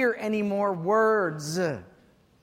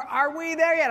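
put-on higher, louder whiny child; nagging kid; 6 semitones higher, 4 dB louder than usual. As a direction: whine it like a bored little kid. Kids nagging again.

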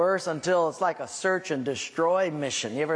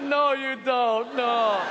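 higher, slow embarrassed protest; flustered denial; 4 semitones higher, much slower than usual. Retold or imitated. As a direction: wave off the praise, pitch up. Embarrassed denial to fan cop.

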